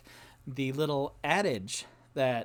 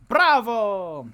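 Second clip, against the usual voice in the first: slow, drawn-out louder cheer; mock bravo; 7 semitones higher, 6 dB louder than usual. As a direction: cheer yourself like a crowd. Self-applause after the chant.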